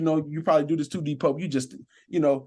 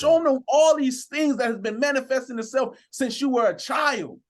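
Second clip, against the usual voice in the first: preaching; 7 semitones higher than usual. Acted. Officer preaching to listeners about speaking life, fervent.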